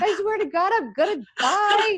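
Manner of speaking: high, loud mock wail, dragging out the end; retold crying. Woman detoxing from sugar to her boyfriend, mock-crying. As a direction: wail it like a hammed-up meltdown. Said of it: "I'll never forget crying".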